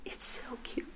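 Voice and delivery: ordinary talk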